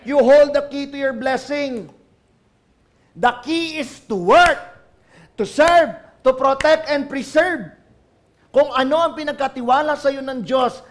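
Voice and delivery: loud, rising, slowing preacher's cry; loud preaching build; fiery preaching. Acted. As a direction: proclaim it over the crowd. Preaching at full force.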